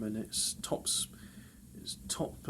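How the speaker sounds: whispered self-correction; hushed aside. Real, not acted. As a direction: drop to a whisper to correct yourself.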